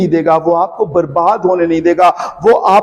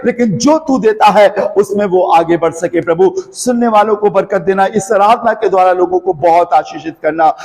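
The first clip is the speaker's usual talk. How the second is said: prayer building into an urgent, higher-pitched plea, easing at the close; fervent prayer; 4 semitones higher than usual. Real, not acted. Preacher praying over his listeners for freedom and healing, fervent prayer. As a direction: pray in a pressing, unbroken stream of petitions.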